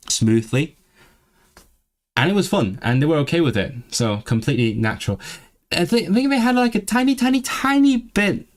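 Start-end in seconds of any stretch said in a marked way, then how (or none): 5.7-8.5 tiny little high voice on each repeated "tiny"; high-pitched emphasis. Real, not acted. Stressing how very small it was.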